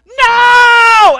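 huge high shout of no; yelled no. Retold or imitated. Yelling at drunk roommates about to throw a friend, full shout. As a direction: scream it to stop them. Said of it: "and I yelled".

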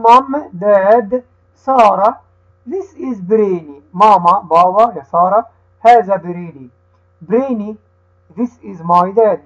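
slow, careful reading with each word set apart for the class to repeat; teacher reads the dialogue slowly so the children can repeat; slow read-aloud for repeating. Shown as a demonstration. Teacher reading slowly to children who repeat after her. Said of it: "هقرأها أنا وهتقول ورايا".

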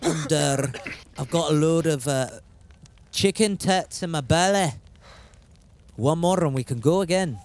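Scottish attempt slipping into Geordie; Geordie accent. Thanking the boys for warm legs, playful Geordie accent. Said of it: "that's Geordie".

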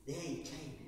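usual speaking voice

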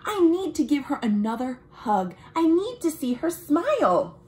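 put-on lively read-aloud for children; story narration. A narrator's reading. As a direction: tell it brightly to young listeners. Reads picture book aloud.

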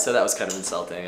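normal talking voice

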